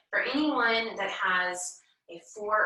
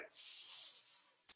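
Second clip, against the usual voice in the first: a soft snake's tss; snake hiss; 7 dB quieter than usual. Shown as a demonstration. Teacher showing kids the snake's hiss, soft. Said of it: "make a snake sound".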